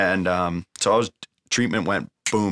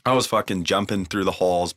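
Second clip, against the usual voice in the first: usual speaking voice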